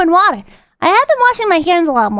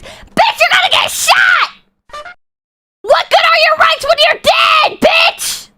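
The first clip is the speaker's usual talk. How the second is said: higher, slow, yelling at the screen; real exasperated yell; 10 semitones higher, slower than usual. Real, not acted. Pitched-up scolding shout. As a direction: streamer yells at the agent to comply, real frustrated shout.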